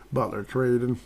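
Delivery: talking through a choking fit; choking while talking. Real, not acted. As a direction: voice catching from choking. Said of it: "Sorry, I'm choking here".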